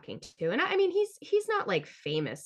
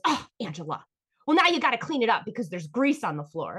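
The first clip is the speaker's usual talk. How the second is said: lightly voiced complaining mother; a hint of a scolding mom; nagging mom; 4 semitones higher, 5 dB louder than usual. Mom scolding her over the grease, mild nag. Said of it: "my mom goes".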